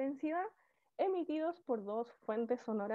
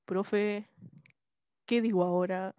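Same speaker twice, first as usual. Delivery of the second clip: lower-pitched copy of a student's question; trying on a deeper voice; attempted tone imitation; 5 semitones lower than usual. Shown as a demonstration. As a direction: drop your pitch to match the student. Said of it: "no, yo lo intenté".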